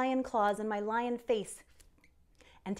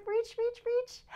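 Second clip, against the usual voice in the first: much higher with each reach; rising encouragement; 11 semitones higher than usual. Acted. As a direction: stretch the voice up with them. Coaching a balancing stretch.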